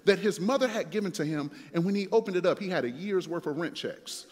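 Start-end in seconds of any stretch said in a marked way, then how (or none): none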